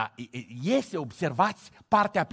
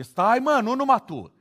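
loud, higher retold interjection; mock interruption; 8 semitones higher, 7 dB louder than usual. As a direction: cut in like a friend, hammed-up. Simi steps out, retold.

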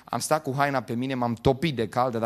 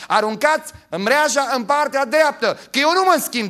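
high, loud proclaiming of Christ's command; Jesus' words proclaimed; 11 semitones higher, 6 dB louder than usual. Acted. Preacher voicing Jesus to the church, loud proclamation. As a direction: announce the Lord's words with force. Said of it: "zice Iisus Hristos".

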